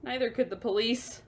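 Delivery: usual speaking voice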